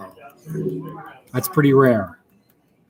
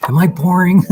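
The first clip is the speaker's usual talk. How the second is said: laughing, higher and louder; 7 semitones higher, 6 dB louder than usual. Real, not acted. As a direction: laugh through a teasing question. Laughing self-mocking question.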